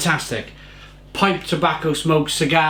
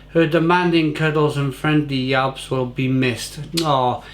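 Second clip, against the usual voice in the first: ordinary talk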